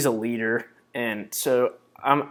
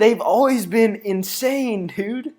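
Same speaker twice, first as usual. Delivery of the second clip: incredulous protest; 10 semitones higher, 5 dB louder than usual. Real, not acted. Fan defending his team to a doubter, incredulous. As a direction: stretch it out high and exasperated. Heated argument over Ohio State.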